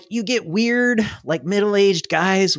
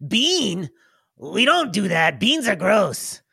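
lightly voiced disgusted goblin; a hint of goblin disgust, slowing on gross; 4 semitones higher, slower than usual. Speg telling his brother beans are gross, mild goblin voice. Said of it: "Speg goes".

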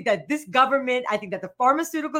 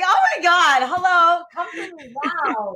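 higher, louder mock rapture; mock eager summoning; 6 semitones higher, 4 dB louder than usual. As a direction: call out to it like a devotee, half joking. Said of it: "I'm just like".

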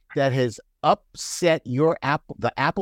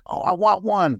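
1 mock eager; 6 semitones higher than usual. Retold wanting a block.

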